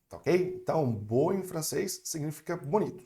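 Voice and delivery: ordinary delivery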